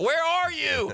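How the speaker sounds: high, urgent question; frantic question. Retold or imitated. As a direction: shout where are you into the phone. Frantic phone call.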